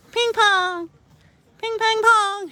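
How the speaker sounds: squeaky high dog call; high playful call. Real, not acted. Owner to dog, squeaky high playful call.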